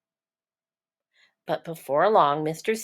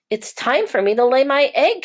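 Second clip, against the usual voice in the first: lightly raised, eager mother seahorse; a hint of a higher, brighter mom seahorse; Missus Seahorse voice; 7 semitones higher, 4 dB louder than usual. Missus Seahorse telling her husband, a touch higher.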